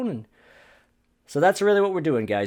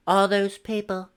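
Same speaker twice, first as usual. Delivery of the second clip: mocking liberal voice; 8 semitones higher, 5 dB louder than usual. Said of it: "saying shit like".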